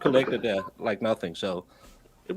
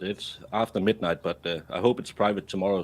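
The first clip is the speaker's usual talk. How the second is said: usual speaking voice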